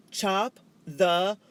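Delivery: very slow, very loud, word by word; loud slow order to someone who won't understand. A narrator's reading. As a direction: spell it out for a foreigner. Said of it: "she said slowly and loudly".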